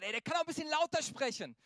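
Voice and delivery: loud, high-pitched demand; impatient complaint. Acted. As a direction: call out like an impatient listener. Acting out impatient listeners' complaint.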